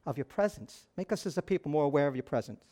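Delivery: soft, low prayer; praying. Retold or imitated. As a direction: quietly plead with the Lord. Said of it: "how often I'm praying".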